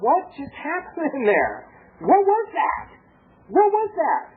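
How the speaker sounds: high-pitched, wondering disbelief; amazed. Retold or imitated. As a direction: act out marvelling at what just happened. Astonished at his own restraint.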